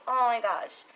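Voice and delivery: ordinary delivery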